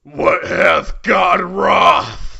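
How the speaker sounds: slow, loud, indignant bellow; outraged shout. Acted. Daiyousei's outrage in the fanfic as a slow, loud shout. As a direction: roar it like an offended preacher. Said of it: "triggered Dios".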